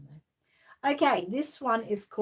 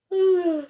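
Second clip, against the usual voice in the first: loud sigh; 11 semitones higher, 10 dB louder than usual. Real, not acted. Tired sigh.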